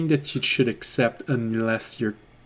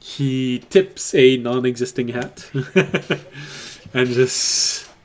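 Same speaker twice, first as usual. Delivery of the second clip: ordinary talk, nothing marked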